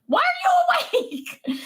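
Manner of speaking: high, slow startled shout. Retold or imitated. Mom retelling her shock at the awake toddler, shouting high. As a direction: yelp it out in shock. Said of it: "And I'm like".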